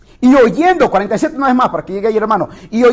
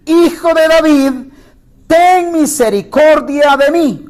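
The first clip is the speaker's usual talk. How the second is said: pleading cry, a little higher and louder; clamoring louder; 5 semitones higher than usual. Retold or imitated. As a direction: beg for mercy, raising the voice. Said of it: "él clamaba mucho más".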